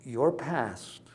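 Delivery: normal talking voice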